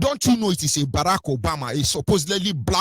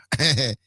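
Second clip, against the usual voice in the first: real chuckle. Real, not acted. Speaker amused after joking about rubbing shoulders, real chuckle. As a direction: chuckle briefly at your own jibe.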